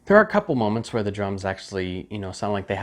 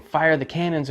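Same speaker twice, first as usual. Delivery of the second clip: mildly raised, higher mock order; a half-shouted command; 6 semitones higher than usual. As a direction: imitate someone ordering cannon fire. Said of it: "like someone saying".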